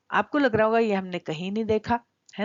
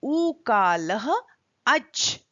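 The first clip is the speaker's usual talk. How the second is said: slow, syllable by syllable; 2 semitones higher, 4 dB louder, much slower than usual. Shown as a demonstration. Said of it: "पहले हम यहाँ देखते हैं".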